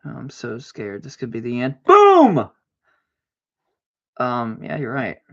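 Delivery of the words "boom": much higher, loud victory yell; triumphant boom. Real, not acted. Landing the move with a high loud yell. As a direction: shout it like a winner.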